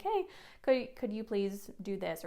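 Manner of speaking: normal talking voice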